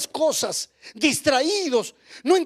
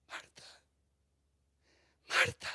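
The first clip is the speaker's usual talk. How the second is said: hushed call; 10 dB quieter than usual. Acted. Preacher calls Martha.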